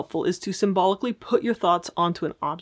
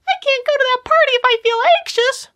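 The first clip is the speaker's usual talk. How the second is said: very high, louder squeaky voice; squeaky silly voice; 7 dB louder than usual. Shown as a demonstration. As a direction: squeak the fear like a cartoon. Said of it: "you start to use weird voices".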